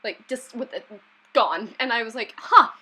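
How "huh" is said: high, loud startled burst; sharp pitch jump of acted surprise; re-enacted disbelief. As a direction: act out being caught off guard. Re-enacts disbelief at sudden death.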